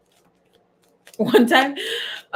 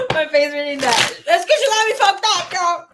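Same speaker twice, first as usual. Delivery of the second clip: loud, high, talking through laughter; cackling, words bursting out high; laughing; 12 semitones higher, 7 dB louder than usual. Real, not acted. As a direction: crack up while exclaiming. Laughing at what she read.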